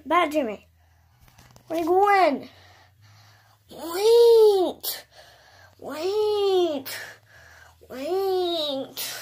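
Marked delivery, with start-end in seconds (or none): 1.7-9.1 high, long-drawn wail fading away; pleading call. Pretend. Calling after Bad Jimmy as he goes, high drawn-out wail.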